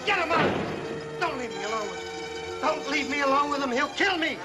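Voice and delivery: rising terrified begging; panicked pleading. Acted. Terrified man begging not to be locked up.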